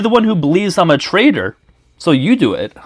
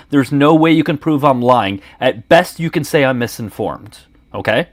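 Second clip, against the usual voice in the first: ordinary talk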